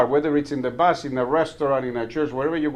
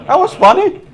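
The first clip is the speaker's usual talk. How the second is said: amused; 8 dB louder than usual. Real, not acted. Amused reaction to restaurant sign.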